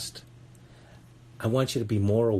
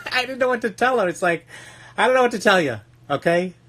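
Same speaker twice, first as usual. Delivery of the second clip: much higher and louder, laughing; laughing disbelief; 12 semitones higher, 7 dB louder, faster than usual. Real, not acted. Telling listeners about the inmate, laughing helplessly. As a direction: throw up your hands, laughing.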